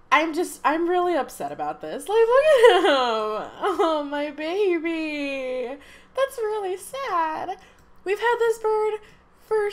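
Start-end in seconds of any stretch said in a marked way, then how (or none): 2.1-3.5 mock crying. Dog mourning the bird. 3.6-7.6 tender. Real, not acted. Pitying the mourning dog.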